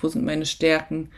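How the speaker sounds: ordinary talk, nothing marked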